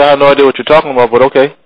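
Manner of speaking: normal talking voice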